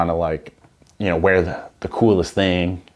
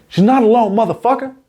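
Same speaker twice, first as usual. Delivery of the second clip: high, loud, fierce mock Sam Jackson yell; loud high mock Jackson bark; Samuel L. Jackson impression; 5 dB louder than usual. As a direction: yell it like a furious action hero. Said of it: "Okay, that's Sam Jackson".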